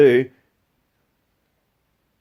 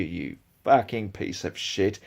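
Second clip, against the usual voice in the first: usual speaking voice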